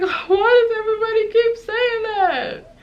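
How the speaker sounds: a hammed-up wail of frustration; mock cry. Exasperated at the repeated warnings.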